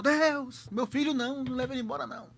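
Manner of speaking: high, pleading retold cry to God; a father's mock-sobbing plea at the coffin; tearful plea. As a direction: beg God through tears, pitched high. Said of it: "E chorou".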